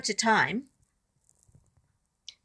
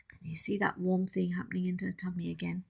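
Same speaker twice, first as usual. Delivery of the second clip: whisper; 2 semitones lower, 6 dB quieter, faster than usual. Real, not acted. Reader whispers to children.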